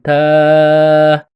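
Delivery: تا held long and loud; long taa. Shown as a demonstration. Teacher demonstrating ta with long alif, drawn out. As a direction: stretch تا out.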